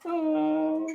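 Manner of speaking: high, slow, mock-weepy voice; mock teary. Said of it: "I'm about to cry".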